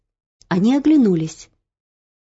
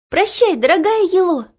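put-on young girl voice; 10 semitones higher than usual. Olya bids farewell.

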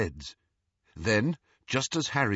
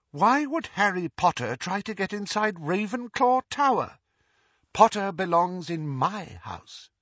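proud disbelief; 9 semitones higher than usual. A narrator's reading. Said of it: "a little strain of pride".

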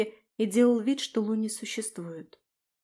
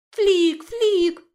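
loud, high creature shrieks; louder squealing; 8 semitones higher, 7 dB louder than usual. A narrator's reading. Dragon demanding attention from those not hurrying, louder squealing. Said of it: "он завопил громче".

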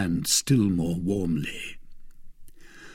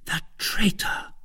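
hushed, unvoiced whisper; murmured; 9 semitones higher, 3 dB quieter than usual. A narrator's reading. Wife hissing at her husband's betrayal, whispered. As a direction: breathe it out in a whisper. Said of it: "murmured Madame Bonacieux".

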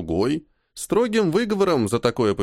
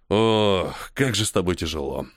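disapproving sigh; 5 semitones lower than usual. A narrator's reading. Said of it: "осуждающе покачал головой".

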